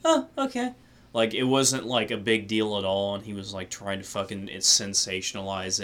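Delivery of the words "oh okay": unbothered. Retold or imitated. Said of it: "she was just like".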